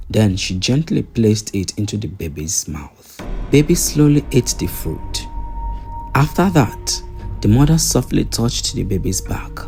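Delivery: plain reading voice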